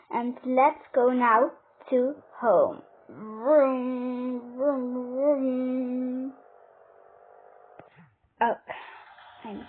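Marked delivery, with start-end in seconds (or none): 3.1-6.3 long drawn-out vroom noises; imitated car engine. Pretending to drive home with the clothes, imitated car engine. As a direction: pretend engine revving, stretched out.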